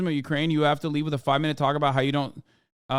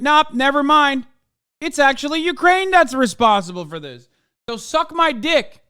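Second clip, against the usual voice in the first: mocking the New York Times; 10 semitones higher, 8 dB louder than usual. Streamer mocking the New York Times' reversal, loud, high sneering mock voice. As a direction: belt it out as a sneering parody of the paper. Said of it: "The New York Times turned around and said".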